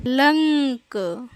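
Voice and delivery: लंक stretched slowly with nasal ng before k; slow demo word. Shown as a demonstration. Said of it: "ये भी एक शब्द है".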